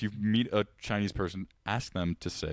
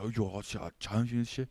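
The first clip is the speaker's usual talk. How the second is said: put-on Chinese accent; 2 semitones higher, 4 dB quieter than usual. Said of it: "that's exactly how they talked".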